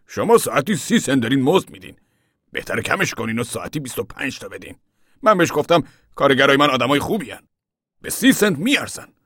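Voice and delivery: heated retelling, often loud and high; farmer retelling an argument. A narrator's reading. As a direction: recount the wage argument forcefully.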